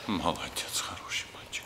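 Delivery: soft whispered praise; whispering. Real, not acted. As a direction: whisper gently to the dog. Praising the settled dog.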